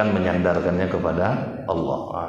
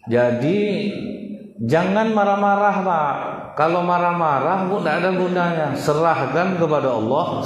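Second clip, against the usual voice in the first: preaching at full force; 8 semitones higher than usual. Real, not acted. Preacher urging the congregation, raised preaching voice.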